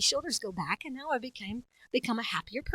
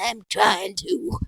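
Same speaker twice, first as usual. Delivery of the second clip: put-on old man voice; 4 dB louder, much slower than usual. Old farmer improv answer.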